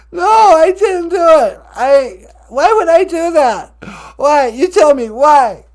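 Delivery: indignant protest. Acted. Juicy protesting loudly.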